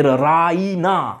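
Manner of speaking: Raina slowly, stretching the middle "ee"; stress on middle syllable. Shown as a demonstration. Mother calling her daughter, Raina with a long stressed "ee". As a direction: say Raina in three syllables, leaning on "ee". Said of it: "এই ইয়েতে সে জোর দিল".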